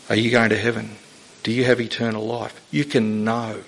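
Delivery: ordinary talk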